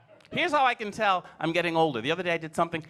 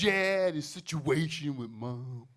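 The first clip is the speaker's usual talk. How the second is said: put-on slow, weary kid voice; weary little boy; 2 semitones lower, much slower than usual. Son repeating his complaint, slow and weary. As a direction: drag it like a put-upon little man. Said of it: "He goes".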